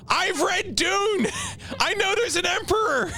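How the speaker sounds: exasperated outburst. Real, not acted. Streamer defending knowledge of Dune, high exasperated outburst. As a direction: wail it high, as if absurdly accused. Arguing back at spoiler accusation.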